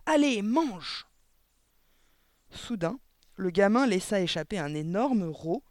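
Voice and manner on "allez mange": loud, high, drawn-out order; shouted command. A narrator's reading. Headmistress orders the boy to keep eating, slow shout. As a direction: push the child on forcefully. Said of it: "cria-t-elle".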